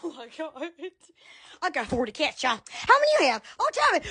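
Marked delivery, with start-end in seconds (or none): none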